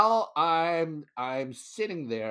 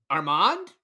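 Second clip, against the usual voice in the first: surprised call of a name, rising; calling a name across the room; loud, questioning call of Armand; 7 semitones higher, 7 dB louder than usual. Retold or imitated. Calling his name in hospital.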